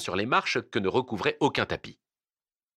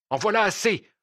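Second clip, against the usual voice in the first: sharp, high-pitched outburst of an angry husband; angry protest; 11 semitones higher, 4 dB louder than usual. A narrator's reading. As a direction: cut him off angrily, pitch leaping up. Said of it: "protesta le Turc en colère".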